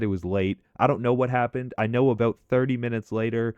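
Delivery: plain reading voice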